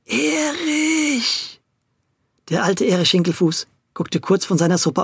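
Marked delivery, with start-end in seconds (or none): 0.1-1.6 high, drawn-out call, slightly stronger; a bit louder. Acted. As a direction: call the name a touch louder. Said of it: "nur einen Tick lauter".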